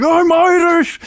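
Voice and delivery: very high, hammed-up Irish accent; Maya's fake Irish. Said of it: "running joke that she's like".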